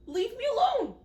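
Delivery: high, loud mock protest. Real, not acted. Joking about her wheezing.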